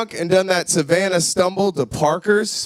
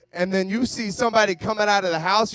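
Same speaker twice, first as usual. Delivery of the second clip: ordinary talk, nothing marked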